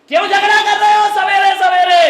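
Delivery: loud, high irritated shout; annoyed yell. Retold or imitated. Sleepy daughter-in-law yells at the family for the noise, irritated. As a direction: yell down from the window, woken up. Woken bahu yells at them.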